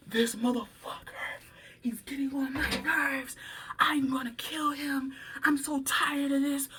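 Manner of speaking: whispered fury through the teeth; angry whisper. Retold or imitated. Coworker quoted, fuming quietly.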